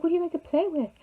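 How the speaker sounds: high, sing-song mock of the caller; lightly put-on high voice, faking enthusiasm; imitating a creepy caller. Stranger on the phone wooing a child, high mock voice.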